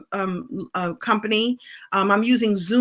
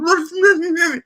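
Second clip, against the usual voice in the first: high, louder garble behind a mask; muffled through mask; 8 semitones higher, 4 dB louder than usual. Retold or imitated. Customer trying to order through a little mask, comically garbled. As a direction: imitate mushy masked speech, pushing to be heard. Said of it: "I had the little mask on".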